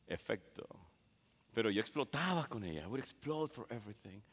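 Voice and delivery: ordinary talk, nothing marked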